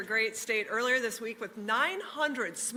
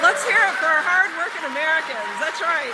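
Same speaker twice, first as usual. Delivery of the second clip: rally cheer; 5 semitones higher, 7 dB louder than usual. Real, not acted. Rally cheer call to crowd.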